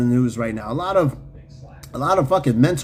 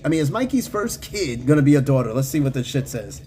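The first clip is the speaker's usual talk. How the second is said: usual speaking voice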